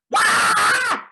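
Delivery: a piercing high shriek to startle; jump-scare yell; sudden scream. Retold or imitated. Son imitating his little boy screaming to surprise, a shrill yell. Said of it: "involve screaming of some kind".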